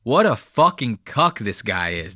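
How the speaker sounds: ordinary delivery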